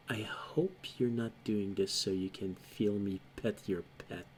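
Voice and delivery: low, soft words meant for the dog; quiet in-character murmur. Acted. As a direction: speak low and softly, close to a pet. Game master voices her murmuring to dog.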